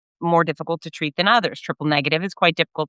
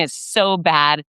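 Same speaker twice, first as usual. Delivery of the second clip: drawn-out, slowed down; slow and heavy; slow emphasis; much slower than usual. Real, not acted. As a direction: stretch each word to show the agony. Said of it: "retelling night pain".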